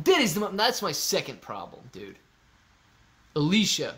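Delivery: normal talking voice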